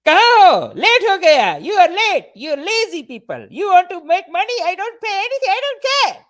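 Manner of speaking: high, loud hammed-up tirade; shrieked mock scolding; yelling mom. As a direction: yell at the help like an imitated angry mom. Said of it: "She's yelling at them".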